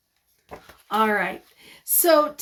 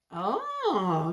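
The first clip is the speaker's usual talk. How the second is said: ordinary delivery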